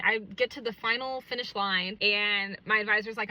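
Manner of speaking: normal talking voice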